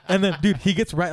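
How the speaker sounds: usual speaking voice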